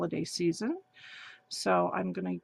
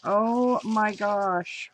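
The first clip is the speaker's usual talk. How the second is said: normal talking voice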